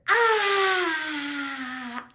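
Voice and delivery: long, forceful open-mouthed exhale; dragon breath. Shown as a demonstration.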